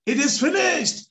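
high, loud shout of joy; joyful shout. Retold or imitated. Father finds the missing piece, shouting for joy. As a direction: yell it out like a delighted child. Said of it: "loudly shouting".

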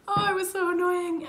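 high, loud exasperated outburst; real exasperation. Real, not acted. Venting about the credit card hassle, exasperated. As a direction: burst out in annoyance, pitched right up.

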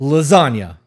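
loud blurted answer. Acted. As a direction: shout it out like a winning guess. Word game, blurting answer.

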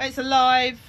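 loud alarmed cry; startled by a live spider; alarmed shout. Real, not acted. Spider found in crate.

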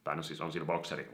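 usual speaking voice

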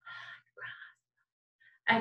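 voiceless whispered demo; hushed whisper for contrast. Shown as a demonstration. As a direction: whisper it slowly, no voice at all. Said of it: "but if I'm whispering".

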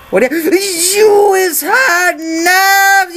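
high, loud, stretched-out Texas holler; Texas accent. Pretend. Officer catches a traveller hiding knives, hammed-up Texas shout. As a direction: shout the accusation in a mock-outraged Texas accent. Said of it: "Gonna stop doing this annoying Texas accent".

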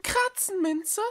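high, startled little cat voice; surprised cry. A narrator's reading. Echo hearing the plant's name, calling out surprised. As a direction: blurt the name back in disbelief. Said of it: "rief Echo".

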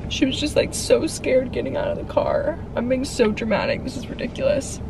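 talking through tears; teary, breaking voice; crying. Real, not acted. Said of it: "I don't know why I'm crying".

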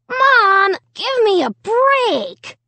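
high, drawn-out whine; whining. A narrator's reading. Son to mom after punishment, whining. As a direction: whine it in protest, stretching words. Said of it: "Jed whined".

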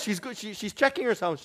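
ordinary talk, nothing marked

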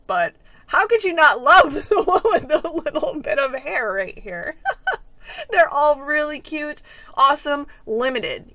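ordinary delivery

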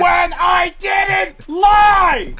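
high, loud outraged protest; indignant shout. Pretend. Player protests to teammate being called a liar, indignant shout. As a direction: stretch it into a wounded yell.

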